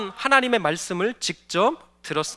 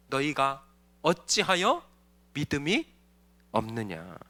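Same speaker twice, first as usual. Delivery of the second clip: much softer, lower sorrowful rebuke; 9 semitones lower, 12 dB quieter than usual. Acted. Jesus rebukes faithless disciples, quiet and low. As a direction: let the rebuke sink in, softly and low.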